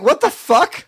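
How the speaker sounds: high-pitched retold disbelief; incredulous outburst. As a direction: relive the shock, voice shooting way up. Retelling his astonishment at rare beer.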